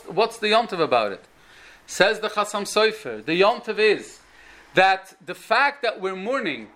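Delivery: ordinary talk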